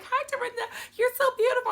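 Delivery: mock sweet fake-friendly voice; imitating Sonya. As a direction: gush like a hostess hiding tension. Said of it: "So she's like".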